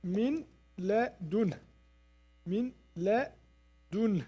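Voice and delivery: min ladun drawn out very slowly and softly; slow, soft Quran recitation; slow quiet recitation. Shown as a demonstration. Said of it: "It should be like that".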